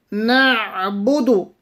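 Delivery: نعبد with the ع from the middle of the throat, slow; ain from the throat. Shown as a demonstration. Teacher showing students the ain in نعبد, slow and deliberate. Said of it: "Ain will be read from the middle of the throat".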